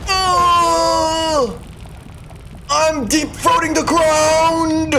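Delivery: real high shout, drawn out; startled yell. Real, not acted. Streamer shouting as his character hits the ground. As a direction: yell as you crash down. Reacting to in-game fall.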